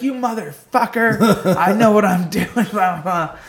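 high, loud mock cursing; imitating a raging neighbor; angry yell.

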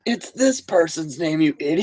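high-pitched yell without much volume; mock screaming. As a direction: imitate an exasperated viewer yelling at the screen. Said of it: "they're, like, screaming at us".

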